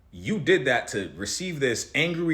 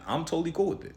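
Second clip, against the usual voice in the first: ordinary delivery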